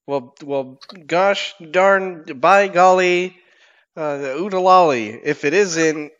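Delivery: mock-cheerful hero hollers about the dead company; hammed-up folksy hero. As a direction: overplay the country gosh-darn cheer. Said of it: "I reply with".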